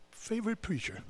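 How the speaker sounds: mocking lilt. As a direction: tease favorite preacher lightly. Mocking repeat of phrase.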